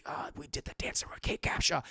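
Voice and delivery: soft unvoiced aside; whisper. Real, not acted. Gossiping to chat in a whisper. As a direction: keep it hushed and sly. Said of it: "whisper continues".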